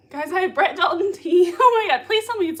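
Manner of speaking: high, loud burst of excitement; thrilled, urgent pleading; excited. Real, not acted. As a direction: gush loudly over juicy gossip. Reacting to viewer's promised gossip.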